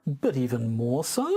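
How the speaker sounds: exaggerated uptalk on so; statement as question. Shown as a demonstration. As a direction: lift SO at the end. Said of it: "making statements as questions".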